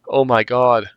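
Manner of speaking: usual speaking voice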